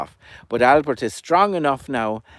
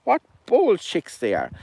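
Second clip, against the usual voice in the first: higher sneering echo of the hen; higher mocking echo by the cuckoo; Albert mocking; 8 semitones higher than usual. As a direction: parrot her words with a smirk. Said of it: "Albert's laughing under his breath".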